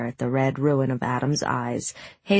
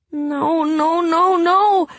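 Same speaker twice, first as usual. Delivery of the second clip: rising, louder despairing no; anguished moan; 14 semitones higher, 5 dB louder, much slower than usual. A narrator's reading. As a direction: plead as the dog dies. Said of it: "Hazel moaned".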